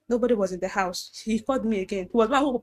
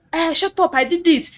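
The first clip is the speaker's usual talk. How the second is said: angry retold pastor; 7 semitones higher, 5 dB louder than usual. Pastor accusing.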